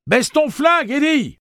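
high, forceful shout; loud voice. A narrator's reading. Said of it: "dit d'une voix forte".

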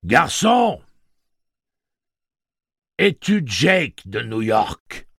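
slow, loud, very high booming call; booming machine voice. Acted. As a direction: call down from the speakers. Blaine calls the boy.

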